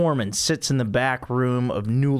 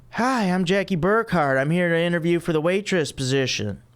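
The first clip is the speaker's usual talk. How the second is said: bubbly Jackie voice; 6 semitones higher than usual. Acted.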